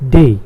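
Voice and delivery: day said as German "deh"; day sounded out firmly as the German letter D. Shown as a demonstration. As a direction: say day with a long pure "eh", no glide. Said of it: "Not Monday".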